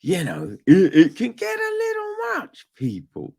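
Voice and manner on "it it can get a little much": high strained cry; exasperated. Retold or imitated. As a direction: whine it out, overwhelmed.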